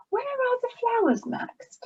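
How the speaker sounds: high, quick, buzzy bee question; buzzing bee. A narrator's reading. Bee buzzing the question to Max. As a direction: buzz it out like a small bee. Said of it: "buzzed Bertie".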